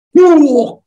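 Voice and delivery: sharp high "wuh" like a heavy suitcase crashing down; imitated loud thud. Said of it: "gitu loh".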